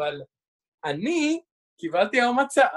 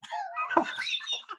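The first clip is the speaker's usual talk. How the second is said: high laugh; 9 dB quieter than usual. Real, not acted. Laughing at the hairy question.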